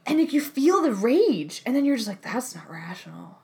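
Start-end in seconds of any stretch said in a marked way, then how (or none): 2.2-3.4 whispered self-talk. Retold or imitated. Calming inner voice.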